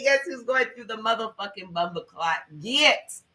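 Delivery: high, giddy laugh-cry; laughing through tears. Real, not acted. Reader celebrating the portal with viewers, laughing and teary. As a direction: laugh it out, almost crying with joy.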